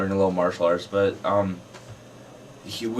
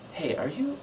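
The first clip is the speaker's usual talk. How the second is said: high, soft, tentative question; curious; 10 semitones higher, 7 dB quieter than usual. Retold or imitated. Filmgoer approaching the actor, curiously high and soft. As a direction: imitate a curious fan, pitched way up. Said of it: "kinda curiously".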